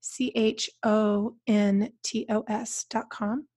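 slow letter by letter; spelled out slowly. Shown as a demonstration. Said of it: "spelling out the web address".

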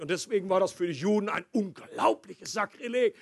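ordinary delivery

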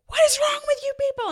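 very high, loud, fast imitated outburst; outraged mock shriek. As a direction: act out yelling at a whole room, shrilly high. Acts out outraged outburst at funeral.

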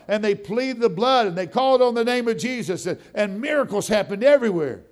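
loud, high, driving preacher's build; preaching at full force. Acted. Telling the church about old-time revivals: full-force preaching. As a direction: preach it out, rising with fire.

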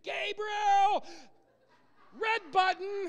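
hammed-up emergency order for the red button; mock panic shout. Preacher mocks the idea of a panicked God, hammed-up alarm shout. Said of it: "He's not scratching his head thinking".

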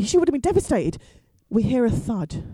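usual speaking voice

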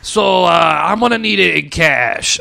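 oily swindler pitch, drawn out. Retold or imitated. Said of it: "like a swindler voice".

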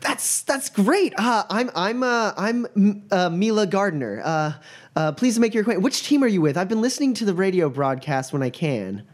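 high, stammering fan voice; flustered student. Acted. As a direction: gush nervously over the famous team. GM voices Mila.

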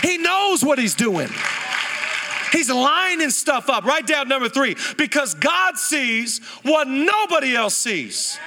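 preacher building to full force; preaching. Acted. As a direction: lift the room with conviction.

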